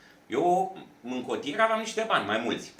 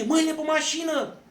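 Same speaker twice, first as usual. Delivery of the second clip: high, loud barked police order; cop yelling; 5 dB louder than usual. Retold or imitated. Police officer to the driver, barking a high, loud order. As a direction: bark it like an aggressive cop making an arrest. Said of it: "zbiera la mine".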